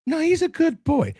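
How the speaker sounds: high falsetto mock mother; doting mom. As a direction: protest in a squeaky put-on mom voice. Mom answering dad in skit.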